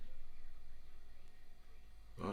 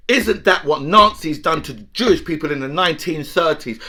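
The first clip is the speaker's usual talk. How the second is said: usual speaking voice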